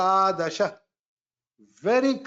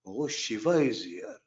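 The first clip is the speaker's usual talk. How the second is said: lower, softer hushed aside; hushed remark; 7 semitones lower, 5 dB quieter than usual. Retold or imitated. Teacher voices an onlooker among silent readers, hushed. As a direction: murmur it so as not to disturb the readers.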